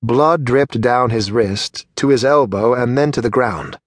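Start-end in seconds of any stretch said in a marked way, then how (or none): none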